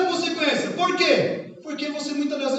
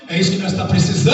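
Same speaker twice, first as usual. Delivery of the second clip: preacher's exclamation; 4 semitones lower, 6 dB louder than usual. Real, not acted. Pastor exclaims to congregation.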